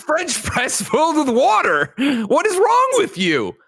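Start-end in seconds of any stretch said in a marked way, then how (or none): 2.3-3.6 mock despair. Mock-anguish at cohost.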